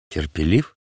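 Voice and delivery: low indignant echo, rising as a question; mildly indignant question. A narrator's reading. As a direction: throw the word back in offended disbelief. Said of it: "возмутился Варава".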